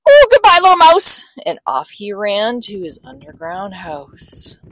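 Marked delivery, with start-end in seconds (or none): 0.1-1.3 high, loud hurried goodbye; panicked farewell. Acted. Fox flees the Gruffalo, high panicked farewell. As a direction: yelp goodbye while bolting.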